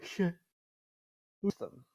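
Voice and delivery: yawning through the word; mid-yawn, words stretched. Real, not acted.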